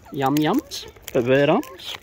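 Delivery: ordinary delivery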